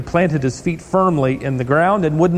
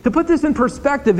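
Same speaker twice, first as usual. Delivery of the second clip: ordinary delivery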